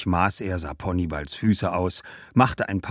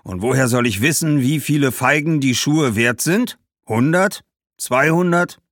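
loud, raised, irritated merchant; exasperated shoemaker; 7 semitones higher, 6 dB louder than usual. Acted. Shoemaker refusing figs as payment, exasperated. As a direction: build in disbelief, then grumble.

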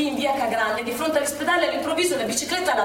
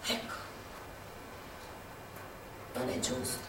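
much softer, barely voiced; broken whisper of grief; 3 semitones lower, 17 dB quieter than usual. Woman mourning her friend, soft broken despair. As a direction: let the words fade out in despair.

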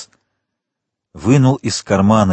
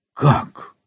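higher, short startled question; astonished exclamation; 4 semitones higher than usual. A narrator's reading. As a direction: exclaim it in sudden disbelief. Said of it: "воскликнул Монте-Кристо".

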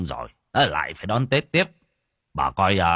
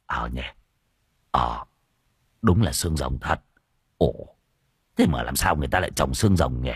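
low, puzzled voice; a simple-minded guy working it out; 7 semitones lower than usual. A narrator's reading. Muôn Phọt wonders why cactus is grown, low puzzled voice.

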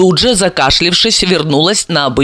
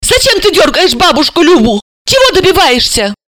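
put-on high, reproachful young girl; high girl's angry reproach; girl shaming; 12 semitones higher, 3 dB louder than usual. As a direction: scold the cousin. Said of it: "пристыдила его".

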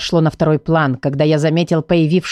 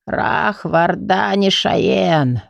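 muttering priest; 3 semitones higher, much slower than usual. A narrator's reading. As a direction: mutter the strange words slowly like an old priest. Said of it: "пробормотал жрец". (бормотание жреца)

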